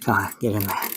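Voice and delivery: out of breath while working; puffing between words, short of breath; huffing and puffing. Real, not acted. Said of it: "Sorry about all the huffing and puffing".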